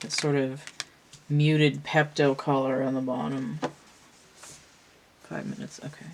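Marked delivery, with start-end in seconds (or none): 5.2-6.1 breathy muttered note to self; whispered aside. Real, not acted. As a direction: whisper it to yourself.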